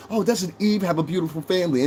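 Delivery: lightly voiced gushing women, a hint of fake sweetness; mock gushing. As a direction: mimic them admiring the family sweetly, half-mocking. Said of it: "they will say".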